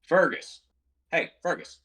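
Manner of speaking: high call across the room to the dog. Real, not acted. Owner calling his big dog away from the cat, high-pitched call. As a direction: raise the pitch and call the dog's name.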